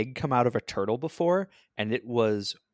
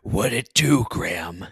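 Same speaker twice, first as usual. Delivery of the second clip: gravelly dramatic voice. Acted. Gravelly dramatic character voice greeting.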